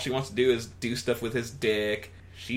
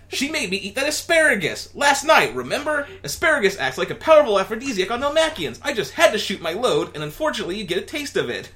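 high, louder, fast comic Alf; Alf explaining; 8 semitones higher, 5 dB louder than usual. Acted. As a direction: rattle off the excuse. Alf voiced.

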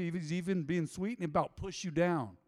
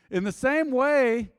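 much louder, higher and slow, every word hammered; preaching emphasis; 8 semitones higher, 12 dB louder, slower than usual. Acted. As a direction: boom it out from the pulpit. Preacher's emphatic scripture opening.

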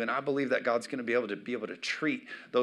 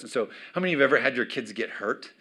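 ordinary delivery

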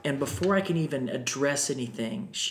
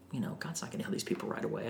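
whispered inner doubt; 3 semitones lower, 10 dB quieter, much faster than usual. Retold or imitated.

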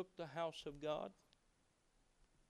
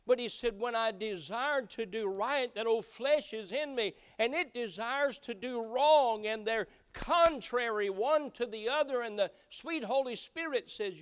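loud, higher preaching voice building the point; full-force preaching; 7 semitones higher, 9 dB louder than usual. Acted. Preacher thunders Paul's words to the congregation, full-force preaching. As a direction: drive it home to the congregation.

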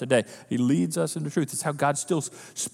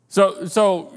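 usual speaking voice

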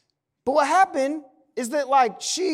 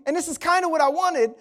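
normal talking voice

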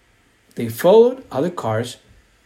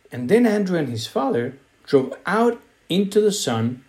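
usual speaking voice

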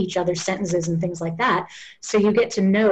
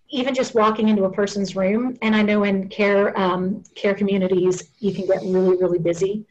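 ordinary talk, nothing marked

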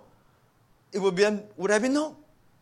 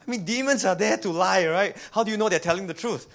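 ordinary talk, nothing marked

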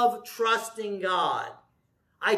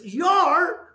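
mock disbelief; 8 semitones higher, 5 dB louder than usual. Bug guy's retold disbelief.

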